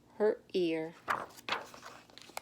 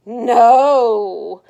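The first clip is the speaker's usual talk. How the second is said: a loud, flat refusal; stubborn loud no; 12 dB louder than usual. Acted. Stephanie refusing her mom's ponytail offer, loud and stubborn. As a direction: plant your feet and refuse.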